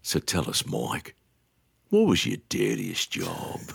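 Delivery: put-on low, close-mic Australian accent. Mocking the Melbourne DJ's big question, low Australian accent. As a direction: lean into the mic, deep Aussie voice. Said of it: "gets right on the mic the way those D Js do".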